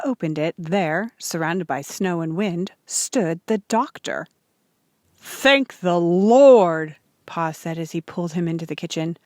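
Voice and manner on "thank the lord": very slow, louder grateful sigh of relief; relieved thanks. Acted. Pa greeting the doctor at the door, relieved. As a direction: let the relief pour out.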